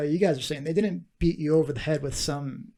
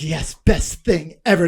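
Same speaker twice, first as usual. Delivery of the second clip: slow, loud mock gushing; retold enthusiasm; 6 dB louder, slower than usual. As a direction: declare it emphatically, word by word. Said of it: "Then he's like".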